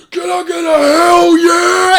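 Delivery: high, drawn-out hype-man shout; loud, slow crowd-rousing yell; hyping up crowd. Retold or imitated. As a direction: imitate a big guy rousing the room. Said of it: "starts hyping everyone up immediately".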